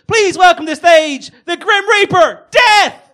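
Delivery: loud announcer build rising to a shout; building stage introduction. Acted. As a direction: whip the crowd up for a big entrance. Host builds up to Death's entrance.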